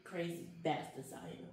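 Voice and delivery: ordinary delivery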